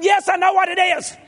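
very high, strained shouted comeback; hammed-up exasperated shout back at the wife; mock harsh retort. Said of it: "said it in a little harsher tone".

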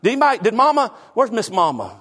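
usual speaking voice

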